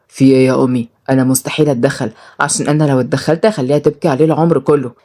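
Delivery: low, resolute male figure; stern son. Acted. Son telling mother he won't intervene, stern.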